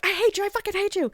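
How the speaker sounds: very high hammed-up fury, not shouted; mock hateful outburst. Irene to her captor Charles, mock furious and high. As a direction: squeal it like an overwrought captive. Acted captive railing at captor.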